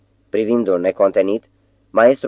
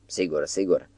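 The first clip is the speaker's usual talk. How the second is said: ironic; 7 dB quieter than usual. A narrator's reading. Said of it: "urmă ironic maestrul".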